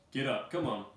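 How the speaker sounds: loud prodding yell; guard barking at prisoners; shouting guard. Acted. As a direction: shove them along with your voice.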